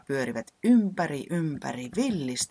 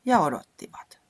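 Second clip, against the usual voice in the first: louder, impatient repeat; emphatic; 4 semitones higher, 6 dB louder than usual. A narrator's reading. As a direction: say it louder, fed up with waiting. Third repeat of waiting, louder. (painokkaasti, kovempaa)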